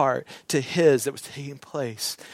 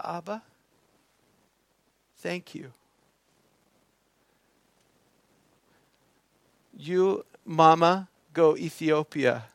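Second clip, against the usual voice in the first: soft, halting imitation of a small son; tender, word by word with long pauses; child's broken English; 4 dB quieter than usual. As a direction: quietly voice a child finding the words. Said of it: "Something like that in broken English".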